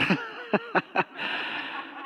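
a soft, unvoiced chuckle; breathy silent laugh. Real, not acted. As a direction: laugh under your breath. Laughs at the epiphany.

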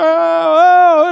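very loud, high mock wail; imitated crying wail. Preacher imitates the crowd all crying, loud wail. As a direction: bawl out a long "oh". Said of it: "they're all crying".

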